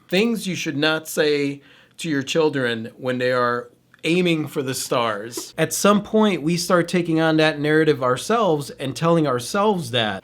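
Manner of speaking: ordinary talk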